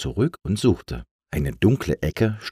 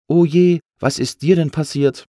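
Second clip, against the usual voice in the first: high, louder cry of sympathy; pitying; 10 semitones higher, 6 dB louder than usual. A narrator's reading. Lufti finds little C wedged in branches, pitying. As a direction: exclaim with concern for a stuck friend. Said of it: "sagte Lufti mitleidig".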